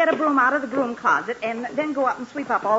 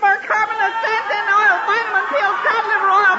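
building tearful wail; 11 semitones higher, 5 dB louder than usual. Acted. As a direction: list it off, rising higher and louder toward tears. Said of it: "crying over spilled iodine".

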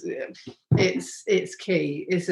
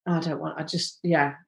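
softer, lower, halting mock refusal; reluctant backing out; 4 semitones lower, 7 dB quieter than usual. Voicing someone changing their mind about sex, quietly reluctant. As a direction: back out quietly, trailing away.

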